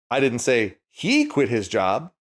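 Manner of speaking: "he" pushed up with a rise; "he" lifted, rest plain; stress on HE. Shown as a demonstration. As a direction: lean on "he" in "say he quit". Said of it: "depending on which word you put the emphasis on".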